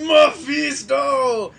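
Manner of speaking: drawn-out, high, loud cry; anguished yell. Retold or imitated. Silver Surfer on his knees calling out Mephisto, anguished yell. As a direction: bellow the name, stretching it out. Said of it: "bent down on his knees going".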